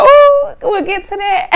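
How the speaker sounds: high, loud teasing sing-song; mock sing-song. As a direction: tease it way up high like you're loving the drama. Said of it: "I said".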